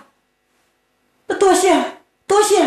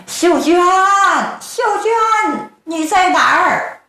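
calling out; 5 semitones higher, slower than usual. Acted. Searching the pine woods, calling.

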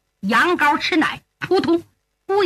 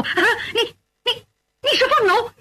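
high, halting, disbelieving question; frightened; 10 semitones higher, faster than usual. A narrator's reading. Mother shrinking from her unexpected son, frightened. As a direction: stammer in shock, pitched high. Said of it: "吓得往后直躲".

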